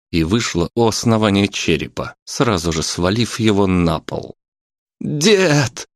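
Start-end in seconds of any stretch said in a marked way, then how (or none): 5.0-5.9 high-pitched cry of alarm; sharp high yell. A narrator's reading. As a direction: call out in alarm. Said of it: "заорал внук".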